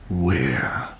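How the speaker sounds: unvoiced hushed whisper. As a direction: breathe the single word. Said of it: "Cinderella whispered".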